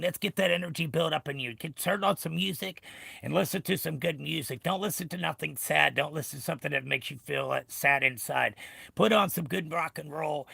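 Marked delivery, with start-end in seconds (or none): none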